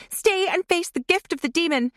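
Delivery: a prankster girl crying out a mock command. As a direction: order her to stay like a cult leader. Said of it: "Leah cried".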